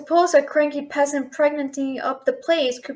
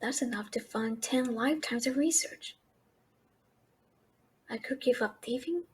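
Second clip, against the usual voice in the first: much softer, low and stunned; awed hush; 4 semitones lower, 14 dB quieter than usual. Real, not acted. Thief hearing the dragon's sapphire offer, hushed and awed. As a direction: say it under your breath, dazzled by the sum.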